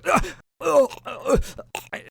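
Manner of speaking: high, broken groans of pain; acted death gasps; dying groans. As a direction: groan as if mortally wounded. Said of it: "Doman just go".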